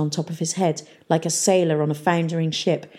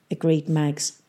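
ordinary delivery, nothing marked

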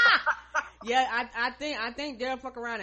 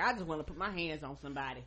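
low menacing mutter; 6 semitones lower, 8 dB quieter, faster than usual. Retold or imitated. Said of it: "Daryl, like".

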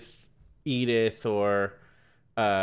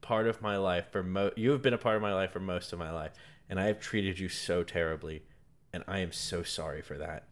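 sincere apology; 6 semitones lower, 6 dB quieter, faster than usual. Retold or imitated. Host voicing Goldie Hawn's soft apology to the butler. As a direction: lower and softer, heartfelt. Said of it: "It's like".

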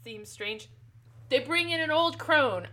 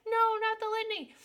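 high whiny protest on no; a tiny fetus moat begging not to hear the litany; 10 semitones higher than usual. Retold or imitated. The fetus moat protesting at Jessica's litany, high and whiny. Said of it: "And the moat's like".

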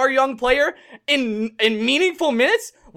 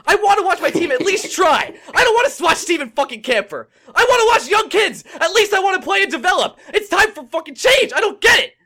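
angry yelling; 6 semitones higher than usual. Real, not acted. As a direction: shout out the frustration at the team. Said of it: "Is this how I sound when I freak out?".

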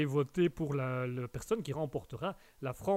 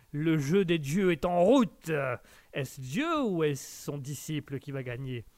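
mock epic announcer; 4 dB louder, slower than usual. Host hams up the vote.

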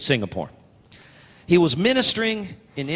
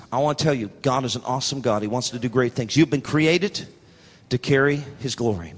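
ordinary talk

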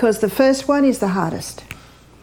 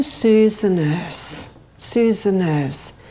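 soothing murmur; 4 semitones lower, much slower than usual. Shown as a demonstration. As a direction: lull the nerves with a slow low voice. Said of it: "demonstrating calm breathing effect".

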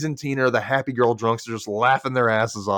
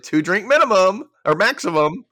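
higher, louder mock hostess rule; bossy quote; 8 semitones higher, 5 dB louder than usual. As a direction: lay down the rules in a mock-strict voice. Quoting the bossy party host.

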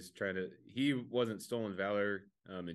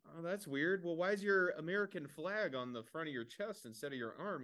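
higher, doubting questions; skeptical questioner; 7 semitones higher than usual. Retold or imitated. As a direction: poke holes in his story, pitched up. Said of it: "And he's like".

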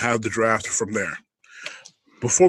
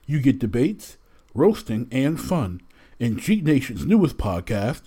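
punchy trailer-style voiceover, slower on the show name; promo announcer read; 3 dB louder than usual. Acted. Host opening the wrestling podcast, promo announcer read. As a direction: sell the show like a radio promo.

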